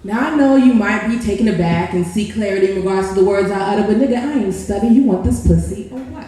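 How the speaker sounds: rising, rhythmic seductive recital; spoken word poem. Acted. Poet seducing a stranger in Starbucks, rhythmic spoken word. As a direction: lean into each line like a slam poem.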